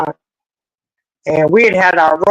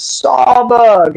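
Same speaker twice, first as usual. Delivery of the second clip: retold scream; 6 semitones higher, 3 dB louder than usual. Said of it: "all I could scream out was".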